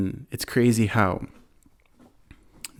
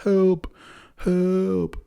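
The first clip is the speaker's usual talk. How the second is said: high, pleading yelp of help, held high; lightly hammed-up high cry for help; mock cry for help; 9 semitones higher than usual. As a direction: squeak it out like a man stuffed in a bag. Kidnapped man pleads from bags.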